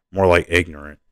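plain reading voice

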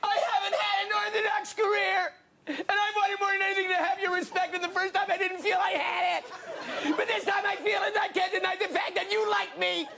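high, tearful, overwhelmed thanks; teary acceptance speech. Acted. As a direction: choke up and cry out the thanks. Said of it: "read Sally Field's nineteen eighty-five Oscar speech".

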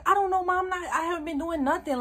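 high-pitched protest of innocence; retold defensive protest. Daughter tells mom she hasn't been doing anything, defensive.

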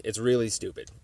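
usual speaking voice

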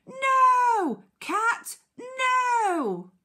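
high, loud drawn-out warning; shout. Acted. Teacher reading Duck catching cat at it, alarmed shout. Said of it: "and he shouts".

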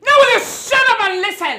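mother yelling at her daughter to listen; angry shout. Acted. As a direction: snap at her to shut up.

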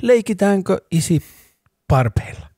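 a hint of a cute toddler; high, childlike question; sweet toddler.

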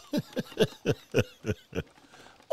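loud giggle; laugh. Real, not acted. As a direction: laugh with glee.

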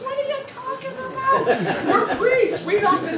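very high, scandalized priest; shocked priest. Acted. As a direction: squeal it out in prim horror. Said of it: "He says".

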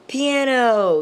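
loud, high, drawn-out cheer; excited announcement. Real, not acted. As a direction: announce the best part with a big burst. Announces favourite part with excitement.

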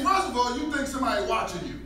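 ordinary delivery

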